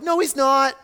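mock a doubter digging in; skeptical objection. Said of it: "You're skeptical".

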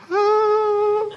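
a sudden high-pitched groan of annoyance; high frustrated groan. Retold or imitated. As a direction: groan up high at the slow drivers. Frustrated groan at slow drivers.

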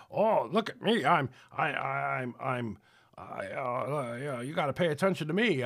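stammering, needy show-off voice; mock attention-seeker. Preacher mocking a needy attention-seeker, stammering voice. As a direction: whine for attention like a spoiled child.

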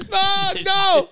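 high mock-frightened cry; mock scared. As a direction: beg as if terrified. Acting out fleeing the sponge.

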